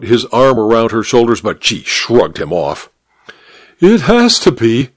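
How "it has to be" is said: insistent. A narrator's reading. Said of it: "she insisted".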